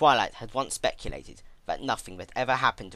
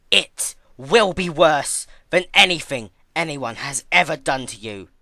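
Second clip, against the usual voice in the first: louder and higher, building in rage; furious vow; 6 semitones higher, 11 dB louder than usual. A narrator's reading. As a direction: swear vengeance with rising fury. Vengeful threat sworn on grave.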